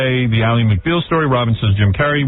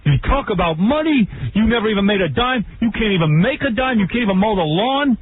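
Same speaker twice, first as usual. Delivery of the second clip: higher-pitched hammed-up tirade; mock-angry father laying into a kid; ranting father; 9 semitones higher than usual. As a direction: scold like an exasperated dad tearing into his son. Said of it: "He goes".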